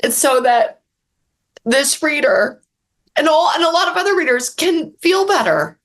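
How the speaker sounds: pushing through real tears; welling up while explaining why she writes; emotional. Real, not acted. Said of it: "I'm getting emotional".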